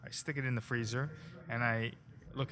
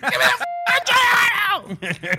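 screamed much higher and louder, stretched out; screaming; 15 dB louder, slower than usual. Retold or imitated. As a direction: scream it at the top of your lungs. Chef Franco screaming.

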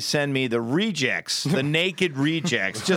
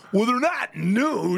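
slow, higher-pitched self-justification; mock defensive husband; 6 semitones higher, much slower than usual. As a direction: drag it out like a husband excusing himself. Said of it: "You defend yourself".

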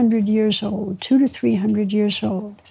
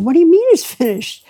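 mildly horrified retold protest, higher pitch; 5 semitones higher, 4 dB louder, faster than usual. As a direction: act out mild horrified disbelief. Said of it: "I'm horrified".

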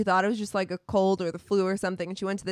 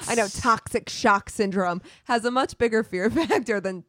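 normal talking voice

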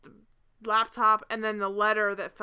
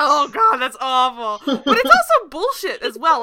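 loud disgusted outburst; outraged outburst; 3 semitones higher, 11 dB louder than usual. Real, not acted. Host reacting to a quoted line, loudly appalled. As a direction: shout it, appalled and laughing.

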